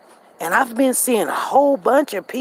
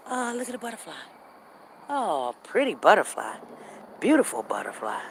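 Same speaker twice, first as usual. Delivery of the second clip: soft delight; 7 dB quieter than usual. Real, not acted. Spots a butterfly mid-walk.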